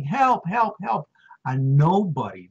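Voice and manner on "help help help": high, urgent mock cry of help; calling for help through the window, pitched up; retold scream for help. Trapped rescuer calling out for help, raised pitched shout.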